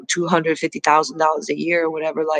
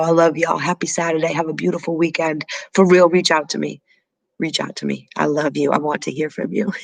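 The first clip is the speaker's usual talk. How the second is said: normal talking voice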